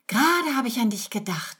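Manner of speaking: high, lilting coo that glides downward; fluting sweetly. A narrator's reading. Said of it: "flötet sie". (zuckersüß geflötet)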